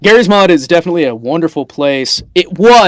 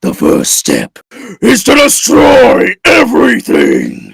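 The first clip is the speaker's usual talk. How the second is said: slow, loud villain decree; booming frost giant; 5 semitones higher, 3 dB louder than usual. Acted. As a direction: decree destruction like a giant tyrant. Villain declares plan.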